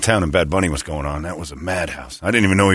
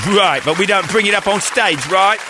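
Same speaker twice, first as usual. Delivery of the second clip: higher, fussy British rocker voice; 11 semitones higher than usual. Acted. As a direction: pipe up to rein in your bandmate.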